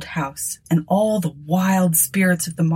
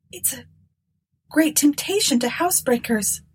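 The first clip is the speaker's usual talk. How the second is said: higher, timid younger sister voice; 8 semitones higher than usual. A narrator's reading. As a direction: voice Eunice as a nervous woman. Eunice voiced.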